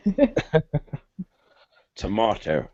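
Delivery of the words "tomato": "Tomato" with a long "mah" vowel, non-rhotic; British tomato. Shown as a demonstration. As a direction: say "Tomato" as tuh-MAH-toh. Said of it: "you guys don't say potato?".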